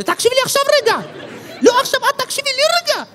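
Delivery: very high, squeaky mock annoyance; irritated boyfriend. Ethiopian friend arguing with girlfriend on the phone, mock irritated. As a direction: imitate a worked-up man far above your own pitch. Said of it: "כי הוא כולו עצבני כזה".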